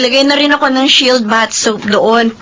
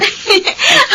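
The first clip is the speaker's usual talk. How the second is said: tearful laugh; 13 semitones higher than usual. Acted. Children greet mother.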